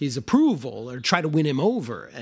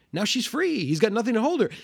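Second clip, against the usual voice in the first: higher, breezy chirpy tone about bad news; mock cheerful; 8 semitones higher than usual. Hospital staff brushing off the stillbirth with mock cheer. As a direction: sound oddly upbeat, a hammed-up cheer. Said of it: "Everyone seems very cheerful about it".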